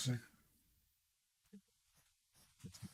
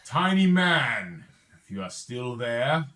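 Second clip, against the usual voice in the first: loud, slow, high-pitched giant's call; frost giant; 4 semitones higher, 10 dB louder, slower than usual. Acted. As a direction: boom it out slowly like a giant hailing someone small. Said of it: "He says to you".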